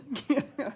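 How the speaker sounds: a quick high laugh at the joke; a brief amused laugh; short high laugh. Real, not acted. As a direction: laugh lightly at the memory. Laughing at own kid impression.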